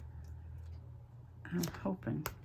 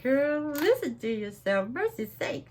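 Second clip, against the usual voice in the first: higher, playful self-scolding exclamation; mock Southern exclamation; 8 semitones higher, 3 dB louder than usual. Crafter teasing herself about her Southern accent, higher playful exclaim. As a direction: tease yourself in a higher voice. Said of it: "Then I was like".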